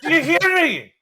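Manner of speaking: a hint of Scottish, high and loud teacher; Scottish accent. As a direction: bark Spanish lessons in Scottish brogue. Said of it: "an impression of a Scottish Spanish teacher".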